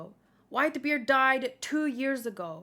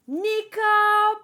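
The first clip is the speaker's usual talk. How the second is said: louder, higher, slow chanted hype; hyped; 7 semitones higher, 7 dB louder, slower than usual. Acted. Reader calling out the big attack, chanted hype. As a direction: belt it like a battle cry. Reading the climax aloud.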